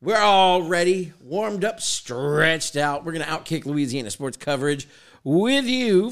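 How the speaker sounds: punchy, drawn-out host intro; big measured sports-show welcome; show-opening announcer. Acted.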